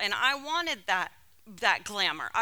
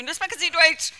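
"No sprechen Sie Deutsch" in clumsy tourist German, higher; a flustered tourist's bad German, raised pitch; lightly pitched-up fake German; mock broken German; 6 semitones higher, 4 dB louder, faster than usual. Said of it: "that's the best I could do".

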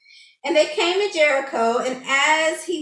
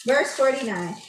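normal talking voice

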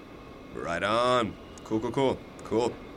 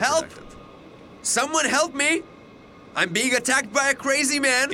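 shouting for help; 12 semitones higher than usual. Acted. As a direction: yell to the whole carriage. Calls for help on train.

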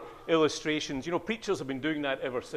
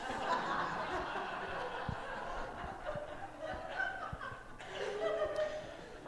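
laughter; 7 dB quieter than usual. Real, not acted. Congregation laughter.

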